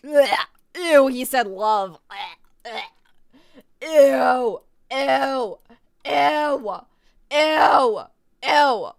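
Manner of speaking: big grossed-out outburst; disgusted. Real, not acted. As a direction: recoil loudly in exaggerated disgust. Disgusted at the word love.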